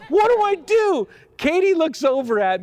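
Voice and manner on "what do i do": high, louder comic panic; panicked. Retold or imitated. Pastor at the wheel as his bowl collapses, high mock panic. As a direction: cry out for help in mock panic.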